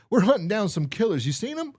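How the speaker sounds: high, loud hammed-up cop voice, squeaking up at the end. Reading the cop's line to the old man in a high, loud put-on voice. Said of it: "The cop goes".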